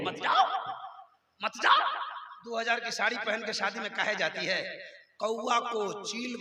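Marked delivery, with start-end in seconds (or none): 0.0-1.8 high-pitched dismissive retort; mock retort. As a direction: toss it back in a much higher voice. Mock retort in marital quarrel. (ऊँची आवाज़ में बनावटी झिड़की)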